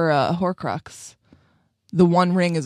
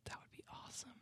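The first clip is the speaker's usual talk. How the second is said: whispering; 32 dB quieter, much faster than usual. Real, not acted. Whispered awe.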